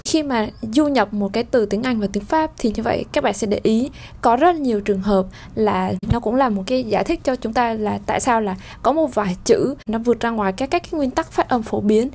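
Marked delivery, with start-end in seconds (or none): none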